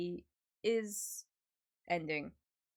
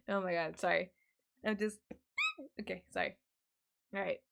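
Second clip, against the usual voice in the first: ordinary talk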